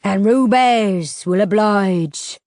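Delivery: slow, drawn-out creature voice; slow ferryman rasp. A narrator's reading. Ferryman Rubers promising passage, slow and menacing. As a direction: draw it out like a river monster.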